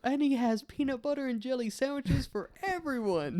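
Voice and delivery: high excited telling; silly high voice. Pretend. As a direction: keep the squeaky excitement. Playful silly voice.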